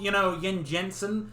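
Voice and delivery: usual speaking voice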